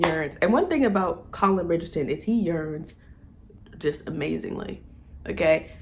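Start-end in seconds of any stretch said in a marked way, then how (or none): none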